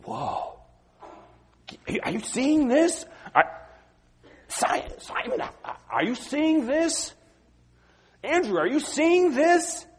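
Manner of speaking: high, astonished calling out to friends; can't believe what they're seeing; amazed disciples. Retold or imitated. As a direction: disciples marvelling at Peter walking on water. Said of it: "and they".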